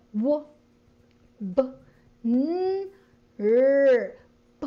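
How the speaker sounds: bare sounds "W", "b", "n", "r", "p" each pinched off alone; isolated phoneme sounds. Shown as a demonstration. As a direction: pinch each consonant, no vowel after. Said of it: "kumurot ka lang dun sa". (kinurot na tunog ng titik)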